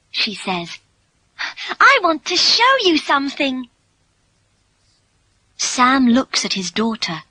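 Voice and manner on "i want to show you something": put-on high, excited girl's voice; excited, pitched well up; eager. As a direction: share a surprise with your father. Daughter excited to show a surprise.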